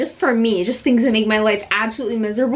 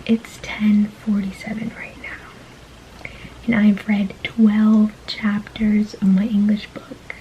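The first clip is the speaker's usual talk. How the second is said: whisper; 2 semitones lower, slower than usual. Real, not acted. Late-night vlog, whispering.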